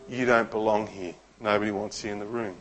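hushed, dropped low and soft; quiet voice of the lie; the lie's voice. Retold or imitated. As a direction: murmur it like a cruel inner voice. The lie whispered into her heart.

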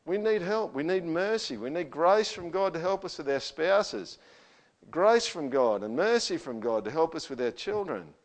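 rising, emphatic pulpit delivery; fervent preaching. Acted. Pastor urging the congregation to seek grace, fervent. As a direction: plead for grace with growing force.

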